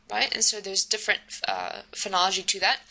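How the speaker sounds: ordinary talk, nothing marked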